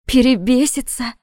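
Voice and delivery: "Перебесится?" asked high and loud in shock; stunned echo. Acted. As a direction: throw back "Перебесится?" in disbelief. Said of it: "ошарашенно выдохнула". (ошарашенно: «Перебесится?»)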